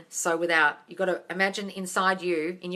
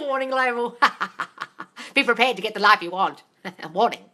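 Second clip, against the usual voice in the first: laughing; 4 semitones higher, 9 dB louder than usual. Real, not acted. Laughing at the comment.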